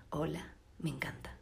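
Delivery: breathy just-woke-up whisper; whispered hello. Real, not acted. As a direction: whisper it, barely awake. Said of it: "mi voz cuando me acabo de despertar". (susurro de recién despertada)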